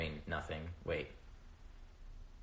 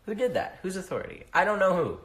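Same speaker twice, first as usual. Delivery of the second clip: exasperated rant; 11 semitones higher, 7 dB louder than usual. Real, not acted. Exasperated rant about gender rules.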